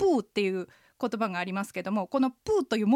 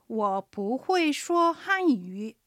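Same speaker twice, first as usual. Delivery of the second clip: slow, careful whole sentence; slow practice read; slow full phrase; much slower than usual. Shown as a demonstration. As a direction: say it through slowly to the end. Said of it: "言い切れるように練習する".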